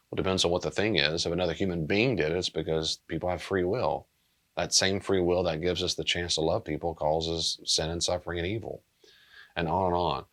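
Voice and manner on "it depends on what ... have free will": normal talking voice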